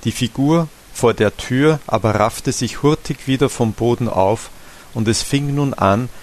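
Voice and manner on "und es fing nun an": ordinary delivery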